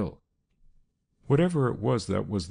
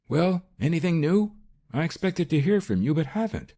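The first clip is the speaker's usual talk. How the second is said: loud, higher booming greeting; 10 semitones higher, 4 dB louder than usual. A narrator's reading. Marlowe striding in, demanding news from Kennedy, loud booming voice. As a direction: boom it out, eager for news. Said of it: "boomed the deep voice of Marlowe".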